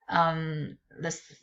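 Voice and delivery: ordinary talk, nothing marked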